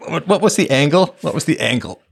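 normal talking voice

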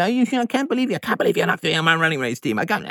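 hammed-up disbelieving coach; mocking pushy coach.

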